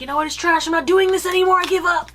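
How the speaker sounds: high, loud exasperated quitting rant; mock frustrated outburst. Venting at a failed project, mock frustrated outburst. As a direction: throw up your hands and quit, hammed up. Imitating a past blow-up quitter.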